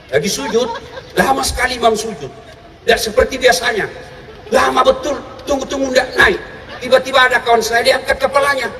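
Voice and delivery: usual speaking voice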